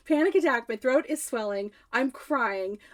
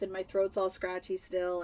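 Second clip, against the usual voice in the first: normal talking voice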